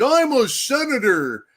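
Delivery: high, drawn-out mock whine; mock whining complaint. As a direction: whine it out in exaggerated indignation.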